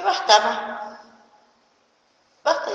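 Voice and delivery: loud, firm "Basta, ma"; sharp retold "enough". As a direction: cut her off hard, twice. Daughter cuts off mother. (cortante y fuerte)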